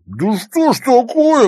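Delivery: high, loud, drawn-out exasperation; slow, loud exclamation of outrage; indignant outburst. A narrator's reading. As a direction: cry out in indignation, stretching the words. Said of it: "возмутился Таранов".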